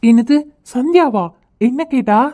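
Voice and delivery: eager surprise. Acted. Boy hearing that Sandhya asked about him, eager surprise. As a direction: perk up loudly at the name.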